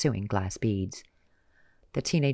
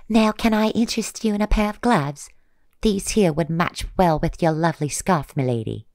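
much higher, louder sales pitch; fawning saleswoman; 6 semitones higher, 6 dB louder than usual. Acted. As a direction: coax the customer sweetly. Vendor woman pitches gloves.

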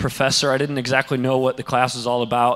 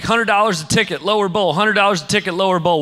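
scalper calling out; 7 semitones higher, 3 dB louder than usual. Retold or imitated. Scalpers hawking tickets on sidewalk.

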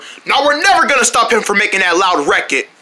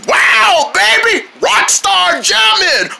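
high, drawn-out hollering; mock rock star; 8 semitones higher, much slower than usual. Player jamming as rock star, high exultant holler. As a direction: wail it like a rocker mid-jam.

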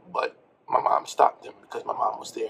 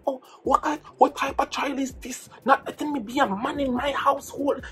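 high-pitched imitation of an outraged father; indignant Jamaican dad; 10 semitones higher than usual. Dad complaining about his child, high indignant rant. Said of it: "He's like".

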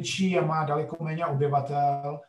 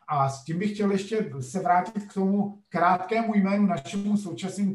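usual speaking voice

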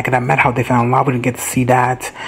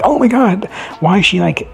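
exasperated outburst; 7 semitones higher than usual. Real, not acted.

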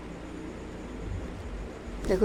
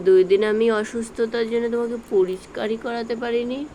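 normal talking voice

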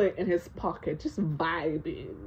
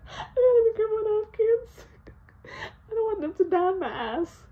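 mock worried laughing; 14 semitones higher than usual. Real, not acted. Mock panic about future.

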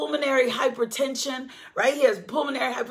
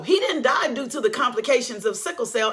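ordinary talk, nothing marked

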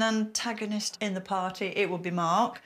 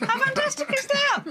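high, loud gushing delight; excited; 4 dB louder than usual. Real, not acted. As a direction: squeal it with delight. Delight at homemade breadcrumbs.

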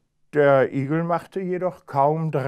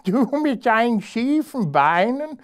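high laughing hare voice; mocking hare; 12 semitones higher, 4 dB louder than usual. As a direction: sneer at his crooked legs. Hare mocks hedgehog's legs.

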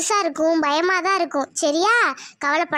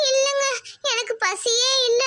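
higher, slow, dejected wife; sad refusal; 7 semitones higher, slower than usual. Acted. Wife refusing dinner from husband, dejected. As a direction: turn the food away listlessly.

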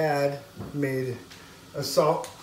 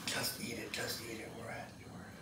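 much softer whispered scolding; hushed mom whisper; 5 semitones lower, 15 dB quieter than usual. Retold or imitated. Mom hushing her child at a friend's dinner, whisper. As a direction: hiss it so the hosts won't hear.

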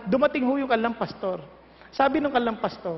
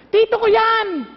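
excited exclamation; 6 semitones higher, 4 dB louder, slower than usual. Retold or imitated. Friend exclaims recognising uncle.

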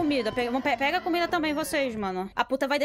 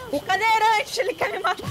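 scared; 7 semitones higher, 4 dB louder than usual. Real, not acted. Fleeing wheelchair monster in game.

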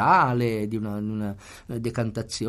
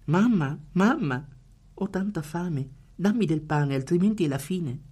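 put-on desperate child; 7 semitones higher than usual. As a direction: plead like a starving child. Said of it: "gridò il bambino, disperato".

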